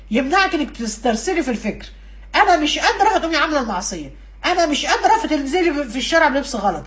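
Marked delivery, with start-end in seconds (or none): 2.3-3.1 high-pitched "I can't"; acted helpless protest. Acted protest before giving in. 4.4-5.2 high-pitched "I can't"; acted helpless protest. Acted protest before giving in.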